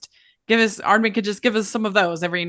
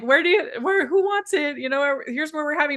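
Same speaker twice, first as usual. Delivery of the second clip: higher, lightly quoted announcer; mock announcement; 5 semitones higher than usual. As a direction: imitate an eager post calling out to fans. Retold social media announcement to fans.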